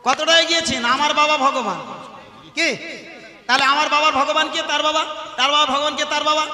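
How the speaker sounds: loud, high preaching to the crowd; fiery preaching. Real, not acted. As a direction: drive the point home at full force. Preaching at full force.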